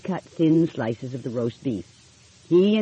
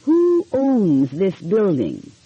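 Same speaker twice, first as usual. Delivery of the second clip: very slow and clear, each word spaced; slow, careful question; slowly; 4 semitones higher, 4 dB louder, much slower than usual. Acted. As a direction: spell it out slowly for a man with little English. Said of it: "Nancy asked him slowly".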